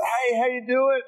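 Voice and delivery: high, bright mock greeting; acted upbeat hello; cheery greeting. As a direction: greet a friend with put-on cheer.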